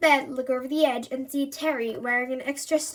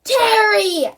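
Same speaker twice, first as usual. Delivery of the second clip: very high, very loud shout; yelling as loud as possible; 9 semitones higher, 12 dB louder than usual. A narrator's reading. Andy shouting at Terry as loud as he can. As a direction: give it everything you've got. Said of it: "I yell as loud as I can".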